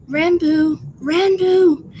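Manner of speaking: loud, high urgent call; Tubbo calling out. Acted. Tubbo calls to Ranboo in the story, calling out. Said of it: "He heard Tubbo's voice".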